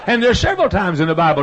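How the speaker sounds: fervent preacher building up; preaching at full force. Acted. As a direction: build the sermon up toward the shout.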